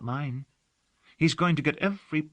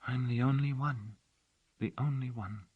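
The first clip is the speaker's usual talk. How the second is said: softly murmured, fading away; under her breath, to herself; 2 semitones lower, 6 dB quieter than usual. A narrator's reading. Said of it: "She murmured, almost under her breath".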